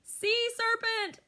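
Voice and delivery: very high, slow terrified shriek; high screaming beagle. Acted. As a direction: howl it out in panic. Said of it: "screams the beagle".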